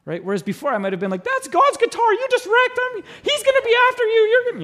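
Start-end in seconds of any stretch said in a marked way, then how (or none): 1.2-4.6 very high, loud screechy mock rant; hammed-up squealing fury, as if calling down judgment; mock wrathful outburst. Pastor imagines his old self scolding the youth, hammed-up fury. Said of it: "called on fire from heaven".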